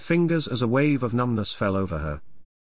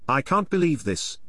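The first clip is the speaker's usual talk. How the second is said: plain reading voice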